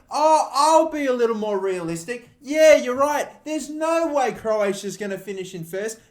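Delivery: high, loud, whiny put-on voice, hammed-up; sarcastic mock apology. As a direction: overact being wounded, dripping with sarcasm. Mocking a commenter's correction.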